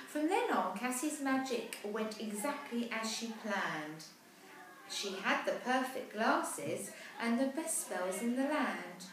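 put-on lively rhyming read-aloud for children; storybook narration. A narrator's reading. Parent reading a rhyming picture book aloud, lively. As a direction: tell the rhyme brightly to a child.

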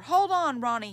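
loud, high rescuer's call; shouting. A narrator's reading. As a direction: shout out to someone in trouble, easing off on the name. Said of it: "he shouted".

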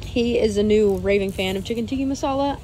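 usual speaking voice

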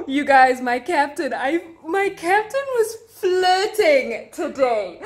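high, slow, thrilled reveal; gasping with delight at the gossip; excited gossip. Real, not acted. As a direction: spill the juicy news breathlessly. Gossiping about flirting captain.

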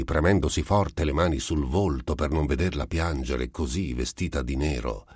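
ordinary delivery, nothing marked

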